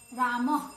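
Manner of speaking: रामः with the final breathy h pushed out loud; visarga demo. Shown as a demonstration. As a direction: breathe out a clear ह at the end of रामः. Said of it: "जैसे बोलेंगे कह". (रामः में अंत की ह ध्वनि ज़ोर से)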